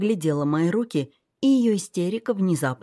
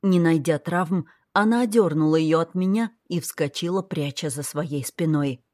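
plain reading voice

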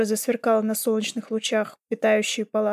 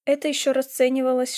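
ordinary delivery

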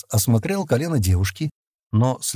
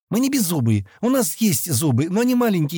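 high-pitched, mildly hurt protest; offended; 8 semitones higher than usual. A narrator's reading. Girl objects to being called toothless, offended. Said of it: "услышала его слова и обиделась".

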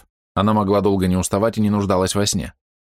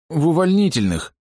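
высокий, дрожащий от страха голос; кается в ужасе, выше обычного; испуганно; 11 semitones higher than usual. A narrator's reading. (испуганный солдат)

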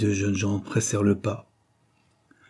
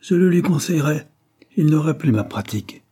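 higher and louder, indignant exclamation; indignant outcry; 6 semitones higher, 4 dB louder than usual. A narrator's reading. Anthony rejecting his mother's suggestion, indignant outcry. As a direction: raise the voice in offended scorn. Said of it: "s'écria-t-il".

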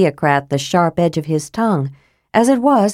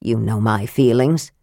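lightly voiced, lower and dour; a hint of a grumpy old dwarf; 7 semitones lower than usual. A narrator's reading. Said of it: "Flint returned dourly".

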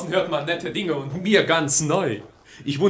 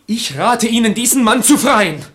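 louder, higher, biting advice; jealous lover advising marriage to a rival, loud and bitter; spiteful; 5 semitones higher, 7 dB louder than usual. Acted. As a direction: throw the advice at her in spite.

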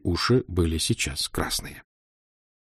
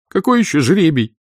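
higher, louder tearful question; sobbing question; 8 semitones higher, 5 dB louder than usual. A narrator's reading. Aglaya sobbing at Dudkin about drawing lots. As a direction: ask through a sob. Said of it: "всхлипнула Аглая".

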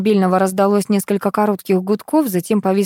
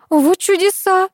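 high, drawn-out astonishment; amazed; 12 semitones higher, 4 dB louder, slower than usual. A narrator's reading. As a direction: marvel slowly in a raised pitch. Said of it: "изумилась супруга режиссёра". (изумлённо, высоко и протяжно)

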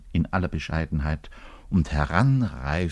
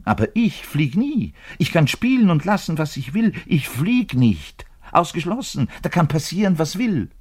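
agitated rant; 8 semitones higher than usual. Acted. Bass player rants that he can never be fired.

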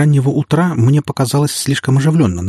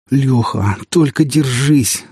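slow, strained urging, rising higher; will him to hold on, drawing it out; through gritted teeth; 4 semitones higher, much slower than usual. A narrator's reading. Pilot racing to sick friend, gritted-teeth urging. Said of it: "сжал я зубы".

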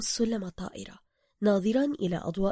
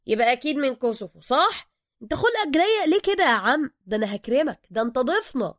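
pushy Cairo driver; 5 semitones higher, 6 dB louder than usual. Acted. Driver character voice.

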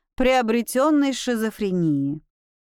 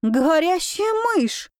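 frightened shriek; 6 semitones higher, slower than usual. A narrator's reading. Heroine shrieks at the bat.

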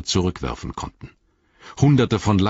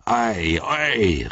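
dwarf calling out; 4 semitones higher than usual. A narrator's reading. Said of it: "rief er".

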